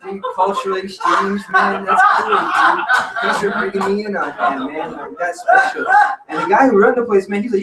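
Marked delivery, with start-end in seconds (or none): 0.0-5.9 high, giggling mock praise; laughing. Real, not acted. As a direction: laugh through the sarcasm, pitched up. Laughing at the absurd offer.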